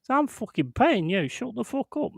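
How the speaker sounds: high-pitched mock outrage, not shouted; indignant retort. Pupil snapping back at his instructor, indignant retold retort. Acted row with driving instructor.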